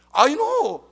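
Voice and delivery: high, loud, drawn-out outburst; retold boy's confident cry; child's insistent exclamation. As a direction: exclaim it high, sure of yourself. Son's sudden cry against all adults.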